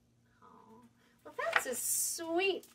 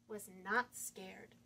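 ordinary delivery

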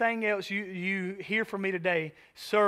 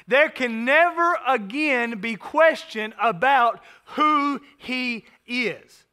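slow emphasis; 4 semitones higher, 4 dB louder, slower than usual. Acted. Preacher slowing for emphasis.

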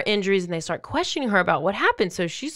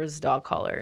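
ordinary talk, nothing marked

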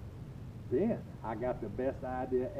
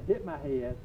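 normal talking voice

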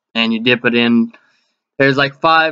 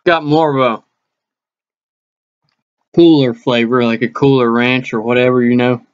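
chewing while talking; talking around food; mouth full; 2 semitones lower than usual. Real, not acted. Seen 2: Bites taquito at -5; mouth shows chewing motions while talking, taquito in hand.

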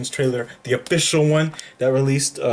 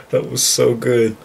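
emotional; 4 dB louder than usual. Real, not acted. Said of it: "I gotta stop getting emotional".

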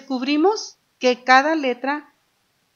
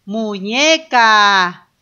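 muñeca split very slowly and louder; slow syllables, louder; 7 dB louder, much slower than usual. Shown as a demonstration. Teacher sounding out syllables for children. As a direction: stress each syllable of muñeca. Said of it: "las voy separando".

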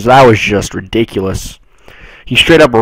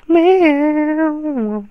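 high, soft mock meow; playful put-on meow; high cat meow; 3 dB quieter than usual. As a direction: tease like a kitten. Makes cat sound.

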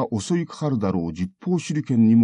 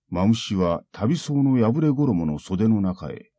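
ordinary delivery, nothing marked

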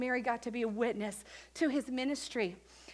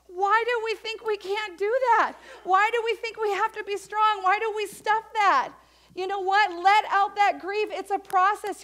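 loud, high urging sermon; preaching at full force; 8 semitones higher, 8 dB louder than usual. Real, not acted. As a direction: press the church to let grief out.

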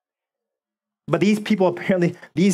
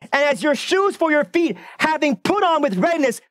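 raised, driving preacher's voice; preaching at full force; 8 semitones higher than usual. Acted. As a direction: build the sermon to its fervent peak. Preaching climax.

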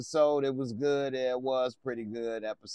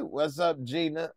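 normal talking voice

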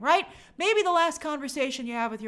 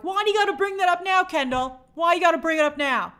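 exasperated; 6 semitones higher, 5 dB louder than usual. Retold or imitated. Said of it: "you're like".

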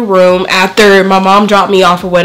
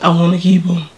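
groggy from anesthesia; 2 semitones lower than usual. Real, not acted. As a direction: mumble half-awake. Groggy patient mumbling.

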